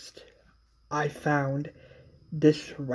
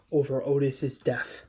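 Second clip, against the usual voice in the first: usual speaking voice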